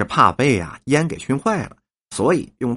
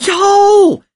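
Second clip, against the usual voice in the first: very high, loud cry of delight; exclaimed "yo"; 9 dB louder than usual. A narrator's reading. Old woman hears the patrons' offer, loud delighted exclamation. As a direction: let out a piercing, drawn-out exclamation. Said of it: "呦了一声".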